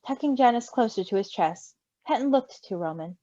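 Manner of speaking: normal talking voice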